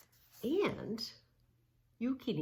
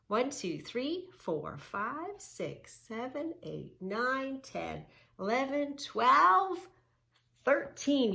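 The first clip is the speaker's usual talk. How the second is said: put-on lively up-and-down counting for kids; sing-song counting; 6 semitones higher, slower than usual. Shown as a demonstration. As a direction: count along like a preschool teacher.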